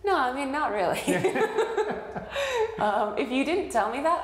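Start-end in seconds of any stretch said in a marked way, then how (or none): 1.1-2.7 high burst of laughter; laughing. Real, not acted. Laughs at her own joke.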